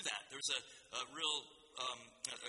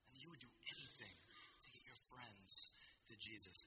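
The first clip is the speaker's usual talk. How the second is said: 1 hushed earnest plea; 3 semitones lower, 9 dB quieter than usual. Real, not acted. Earnest appeal to the youth group.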